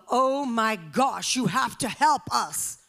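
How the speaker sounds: loud, slow, emphatic frantic plea; frantic frenzy. Retold or imitated. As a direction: act out a desperate cry for help. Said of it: "it was this frantic frenzy".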